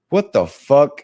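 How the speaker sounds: loud, high disbelief; loud stunned cry; shocked outburst. Retold or imitated. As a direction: blurt it out, stunned. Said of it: "And I was like".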